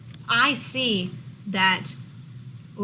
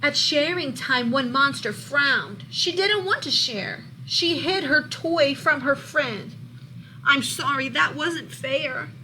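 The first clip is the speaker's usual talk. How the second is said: lively, louder storybook read-aloud; reading to children; 3 semitones higher, 6 dB louder, slower than usual. A narrator's reading. Teacher reading a monster book to her class, lively storybook voice. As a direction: bring the picture book alive for the class.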